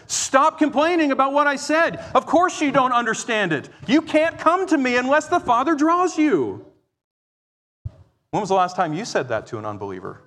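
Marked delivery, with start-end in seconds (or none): 0.1-6.7 high-pitched, emphatic scolding as Jesus; exasperated rebuke. Acted. Jesus answers people grumbling over his teaching, exasperated rebuke. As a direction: rebuke them sharply, pitched well up.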